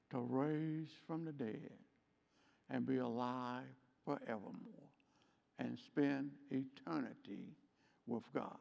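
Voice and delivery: hushed reverence. Real, not acted. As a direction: speak of eternity gently.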